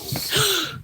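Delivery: sharp high gasp; sudden audible intake of breath. Real, not acted. Vlogger reacts to her cat's mischief, gasping.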